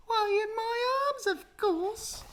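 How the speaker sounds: very high mocking sing-song; mimicking the monkey. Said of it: "That's what she's saying".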